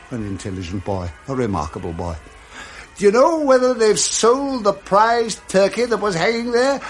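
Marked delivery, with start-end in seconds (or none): 3.0-6.8 high, eager calling from a window; a bit higher, coaxing the boy; eager old Scrooge. Acted.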